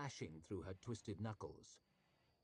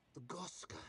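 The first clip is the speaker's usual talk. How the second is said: high, hushed cry of recognition; sudden dread caught in the throat; terrified gasp; 7 semitones higher than usual. A narrator's reading. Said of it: "a note of strange terror in it".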